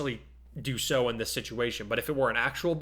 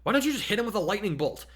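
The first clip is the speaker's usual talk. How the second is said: snarling demand; 9 semitones higher, 3 dB louder than usual. A narrator's reading. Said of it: "Jiro snarled".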